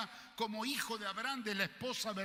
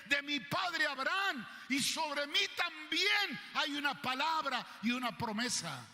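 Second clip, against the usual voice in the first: shouting to heaven; 6 semitones higher, 3 dB louder than usual. Acted. Abandoned boy shouting his claim up to heaven, loud. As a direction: hurl it up at the sky. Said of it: "él le gritaba al cielo".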